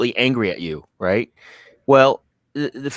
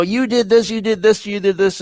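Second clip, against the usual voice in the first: higher, accusing mock yell; imitated yelling; 10 semitones higher than usual. Imitating an angry customer blaming you, mild mock yell. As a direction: jab each you did this like a mild mock rant. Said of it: "they're yelling at you".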